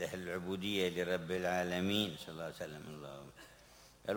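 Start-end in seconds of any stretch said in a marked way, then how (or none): none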